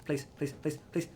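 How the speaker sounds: soft, tense repeated begging; hushed pleading. Real, not acted. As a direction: plead under your breath. Begs the enemy not to turn.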